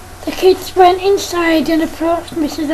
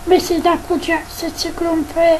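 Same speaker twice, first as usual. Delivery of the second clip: usual speaking voice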